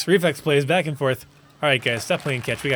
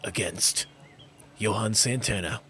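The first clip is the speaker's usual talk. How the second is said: lively baseball announcer call; sports play-by-play; 4 dB louder than usual. Acted. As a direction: call the game like a broadcaster.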